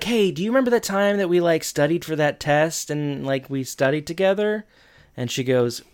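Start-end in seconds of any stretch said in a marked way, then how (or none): 0.0-4.7 high, hurried appeal; imitated eager friend; mock eager reminiscing. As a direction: rush hopefully into a shared memory, pitched up.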